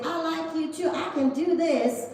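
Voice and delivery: high, hammed-up Southern American twang; put-on American accent. Eager trainee answering the boss, high mock American accent. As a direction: push an American accent up high, drawing out the ending. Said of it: "I can do the accent".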